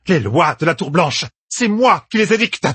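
rising high on an imperious retort; furious authority. A narrator's reading. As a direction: assert your power in a rage. Elaïda impose furieusement son autorité. (réplique impérieuse et furieuse)

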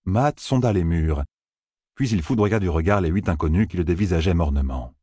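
ordinary delivery

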